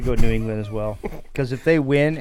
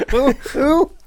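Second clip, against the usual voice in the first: mocking impression; 13 semitones higher, 5 dB louder than usual. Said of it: "if you notice his picks, he goes".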